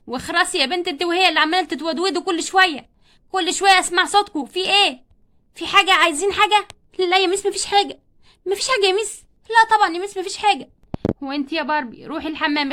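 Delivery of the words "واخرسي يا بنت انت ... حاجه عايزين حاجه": loud, sharp telling-off; angry scolding. Acted. Teacher yells at the pupils who keep chatting. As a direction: shut the chatter down.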